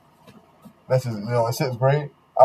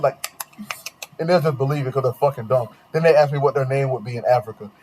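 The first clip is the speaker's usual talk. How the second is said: normal talking voice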